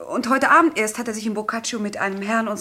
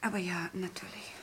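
hushed compliance; 11 dB quieter than usual. Acted. Witness asked to leave them alone, hushed. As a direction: give way very softly, almost without voice.

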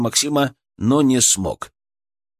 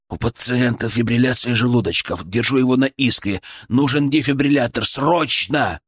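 ordinary delivery, nothing marked

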